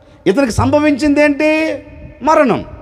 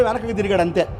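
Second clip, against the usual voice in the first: usual speaking voice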